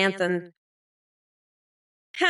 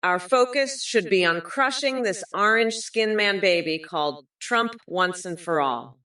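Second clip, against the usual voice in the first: normal talking voice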